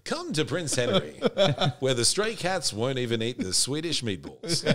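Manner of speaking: laughing through a mock ad; giggling while joking. Jokey mock hospital ad.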